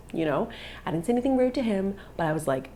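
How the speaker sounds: plain reading voice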